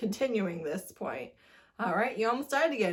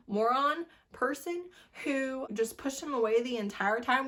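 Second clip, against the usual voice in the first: ordinary talk, nothing marked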